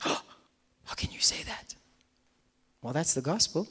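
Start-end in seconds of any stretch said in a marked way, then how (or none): 0.0-1.8 hushed, breathy doubting aside; mock whisper. Preacher voicing a doubter in a hushed whisper. Skeptic objecting to the gospel.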